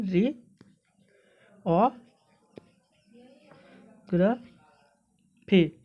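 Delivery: जी, ओ, ग्र, फी as separate sounds with long pauses; teacher isolating each piece: जी, ओ, ग्र, फी; syllable by syllable. Shown as a demonstration. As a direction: sound out जी ... ओ ... ग्र ... फी one at a time. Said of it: "इसका sound कुछ इस प्रकार से भी".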